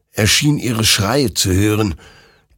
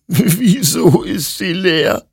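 high, slow, sobbing question; 12 semitones higher, 5 dB louder, slower than usual. Acted. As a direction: sob it out in disbelief. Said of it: "schluchzte Hector".

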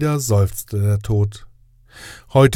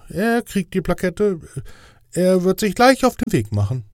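higher, official angel voice; fussy inspector angel; 6 semitones higher than usual. Acted. Inspector angel grading Death's test, higher official voice. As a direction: play the strict inspector angel, pitched up. Radoril gives in to management.

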